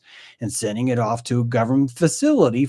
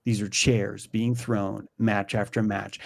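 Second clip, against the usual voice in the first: normal talking voice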